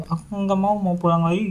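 put-on high, soft whining little kid; whiny child voice. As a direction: whine like a tired toddler. Father voices a tired child.

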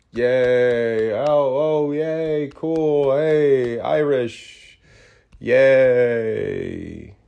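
thin, forced little cheers from a stunned crowd; half-hearted mock cheer. Crowd awkwardly cheering the Notre Dame announcement, hollow mock cheer. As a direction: fake some polite, hollow excitement.

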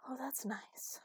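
breathy whisper. Acted. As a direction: whisper it, drowsy and pleased. Drowsy sigh under his touch.

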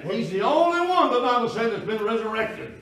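forceful preacher's proclamation; emphatic preaching. Real, not acted. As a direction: drive the point home at high pitch. Preacher insisting on doctrine.

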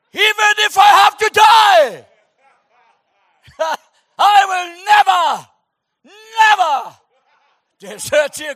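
very loud, high, laughing oath; overblown vow. Acted. Peter outdoing himself vowing to Jesus, hammed-up shout. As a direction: shout the promise like a ham actor. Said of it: "Here he goes again".

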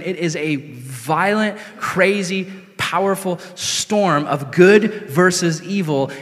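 ordinary talk

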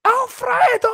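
high, loud celebratory yell of the name. Retold or imitated. Fan yelling a player's name at the telly, loud joyful shout. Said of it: "I was actually yelling".

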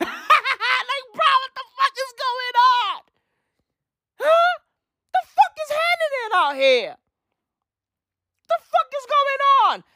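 very high, comic disbelief; incredulous squealing. Acted. Comedian baffled by the news, high incredulous squeal. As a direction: cry out high, hammed-up bafflement. Incredulous rant after repeated impression.

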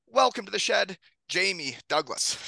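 hoarse from a cold, frog in throat; croaky sick voice with a frog in throat. Real, not acted. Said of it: "Sound like I swallowed a frog".

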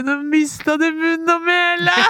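high, slow mock-astonished teasing. Real, not acted. Teasing the speechless host in a high, drawn-out voice. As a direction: stretch it out to tease him.

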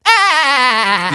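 high, loud cartoon yelp; SpongeBob voice. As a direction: shriek it like SpongeBob. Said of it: "That's all I can do, by the way, from SpongeBob".

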